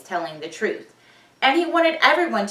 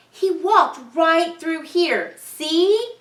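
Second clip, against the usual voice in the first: high, slow, loud pleading; eager insisting; 8 semitones higher, 6 dB louder, much slower than usual. Acted. Ben pleading with the crowd, high and slow. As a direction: point and insist he was here.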